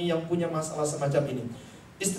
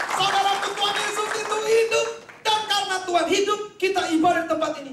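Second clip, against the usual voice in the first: preaching at full force; 11 semitones higher than usual. Acted. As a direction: whip the crowd up. Preacher rallies applause.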